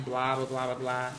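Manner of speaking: ordinary delivery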